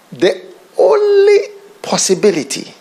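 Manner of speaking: a cracking high cry of emphasis; emotional cry. Real, not acted. Preacher stressing the only way, crying out. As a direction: let the voice break upward.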